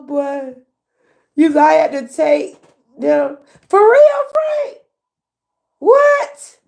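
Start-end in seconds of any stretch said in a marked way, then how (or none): 3.7-4.8 loud high for real; excited outburst at Frank. Real, not acted. 5.8-6.6 high loud what; a shocked high what; startled question; surprised. Real, not acted. Shocked reaction.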